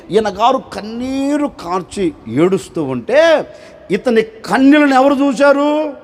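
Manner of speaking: normal talking voice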